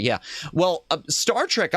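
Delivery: ordinary talk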